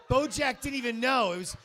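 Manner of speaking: loud, high laughing yell; laughing shout. Retold or imitated. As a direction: crack up while telling the hit. Mob hit told with glee.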